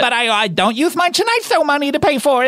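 high, put-on old lady voice; grandma voice. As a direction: play a sweet grandma on the phone. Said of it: "you sound like when Adam Sandler does a grandma voice".

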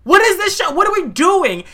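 high-pitched, stunned outburst; jaw-dropped disbelief. Retold or imitated. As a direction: squeal it in utter disbelief. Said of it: "My mouth dropped".

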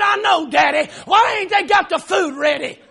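child's mimicking outrage. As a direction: play the six-year-old echoing Daddy's rant. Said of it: "influencing my child to act crazy like me".

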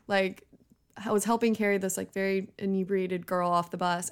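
ordinary talk

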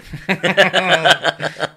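big burst of real laughter; loud laugh. Real, not acted. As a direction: laugh out loud, caught off guard. Laughs at the doctor joke.

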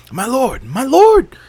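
very high, loud panicked call; crying out. A narrator's reading. Servant fleeing the fire to the king, panicked cry. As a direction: shriek for the king. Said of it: "cried another of the animal servants".

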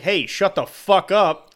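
slow mock snap at the bandmate; retort. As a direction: bark it back at the bandmate. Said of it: "I'm gonna be like".

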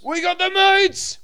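high, slow shouted catchphrase; yelled slogan. Acted. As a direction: greet callers by shouting it. Phone bit.

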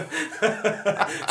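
high loud burst of laughter. Real, not acted. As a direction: let out a big laugh. Laughs at own joke.

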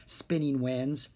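plain reading voice

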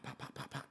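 soft, rapid run of pops; imitated gunfire. Arguing, then shots at the keg party, imitated gunfire. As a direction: mimic shots going off with the lips. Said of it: "then you hear pop".

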